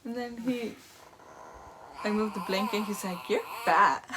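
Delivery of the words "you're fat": loud, blurted sibling tease; imitated blunt teasing; teasing little brother. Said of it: "He just went like that".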